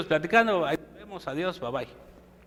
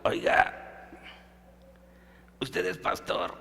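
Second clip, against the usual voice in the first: hushed, breathy question, much lower and softer; whispered voice; 9 semitones lower, 8 dB quieter, much slower than usual. Retold or imitated. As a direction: whisper it like a dying man behind a curtain. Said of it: "así con esta voz". (susurro débil del paciente quemado)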